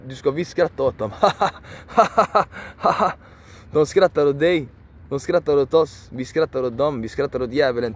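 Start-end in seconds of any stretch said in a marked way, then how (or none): 1.2-3.1 high laugh, louder; mocking laugh. Real, not acted. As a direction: laugh scornfully at them, high. Laughing at the rule.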